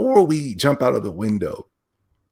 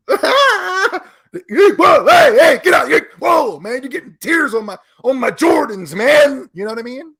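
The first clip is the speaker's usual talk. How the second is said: much higher, loud startled shouting; mock startled yell; 7 dB louder than usual. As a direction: yell like someone backing away from tears. Man pushing off crying woman.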